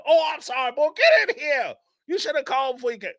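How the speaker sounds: high, hurried mock apology; old grandfather voice. Grandfather letting the grandson in, high hurried scolding apology.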